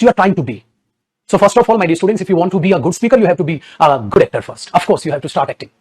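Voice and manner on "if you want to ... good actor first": ordinary talk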